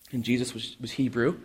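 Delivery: ordinary talk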